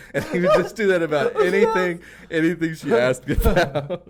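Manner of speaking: high and loud, laughing through the words; can barely get it out for laughing; laughing while talking. Real, not acted. Laughing at the TikTok bit to a friend, high and loud.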